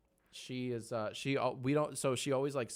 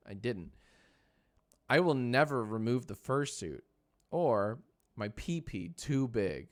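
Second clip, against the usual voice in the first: normal talking voice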